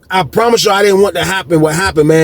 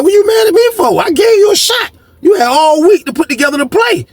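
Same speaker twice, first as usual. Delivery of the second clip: high-pitched, heated acted-out protest; indignant protest. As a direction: retell it as an exasperated challenge, voice way up. Retold protest at being blamed.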